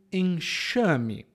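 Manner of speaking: "enxame" very slow, X as sh; "enxame" slowly, with a clear sh; sh sound. Shown as a demonstration. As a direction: model "enxame" drawn out as en-SHA-me. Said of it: "o som é de sh".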